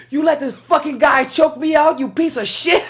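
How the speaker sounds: high, drawn-out mock tearful rant; crying, cursing boy. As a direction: imitate a wailing kid cussing someone out. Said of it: "he's crying".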